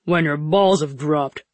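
put-on slow, slurred drunk voice; slurring drunk. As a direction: slur it like you've had too much. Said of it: "He was already slurring his words together".

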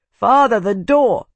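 high, loud urgent call. A narrator's reading. Son warning his father about the door, loud. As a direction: shout the warning across the room.

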